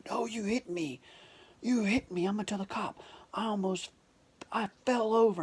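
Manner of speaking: put-on outraged victim; aggrieved complainer. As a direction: imitate an indignant man whining his accusation. Man's complaint acted out.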